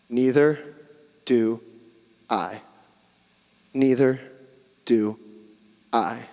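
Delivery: low, tender words with long pauses; gentle, solemn. Acted. Jesus speaking to the condemned woman, gentle and solemn.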